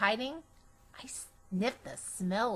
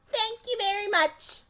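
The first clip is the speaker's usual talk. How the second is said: high, proud thank-you to the audience; a kid bowing to an imaginary class; pretend teacher bowing; 11 semitones higher than usual. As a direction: take a bow like a performer. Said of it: "I said".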